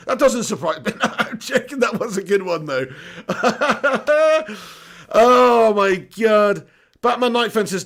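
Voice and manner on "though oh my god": usual speaking voice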